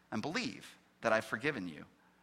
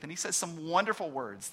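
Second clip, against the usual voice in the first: usual speaking voice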